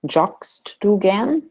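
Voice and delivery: slow yes-no question rising at the end; rising question tune. Shown as a demonstration. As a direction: end "gern" on a clear upswing. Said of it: "noted with the voice going up in the end".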